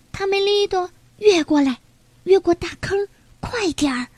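light high call of a small animal urging a friend on; 焦急呼喊的小动物. Acted. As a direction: hurry him across, voice lifted. Character calls across the pit. (略带焦急的高声呼唤)

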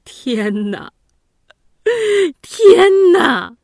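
天哪 rising to a loud wail; wailing cry. A narrator's reading. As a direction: cry out over his brother's head. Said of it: "捧着公子寿的脑袋大哭". (抱头痛哭地喊)

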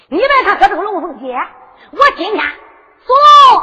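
loud challenge building to a high shout; defiant challenge. Acted. Captive Liu Xiu challenges Su Long, loud and defiant. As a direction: build up to bellowing the name.

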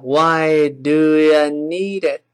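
"Why do you need it" stretched in one unbroken glide; "do you" as "d'ya", "need it" joined, very slowly; slowed linking demo. Shown as a demonstration. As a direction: drawl it out fully linked. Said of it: "就这么一个声音".

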